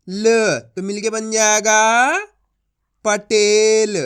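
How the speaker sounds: slow and loud, sounding out each letter; teacher blending letters into a word; slow spelling-out demo. Shown as a demonstration. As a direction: drag out every syllable for the class, then say the whole word. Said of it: "अब देखो किस तरीके से अपन इन शब्दों को पढ़ेंगे".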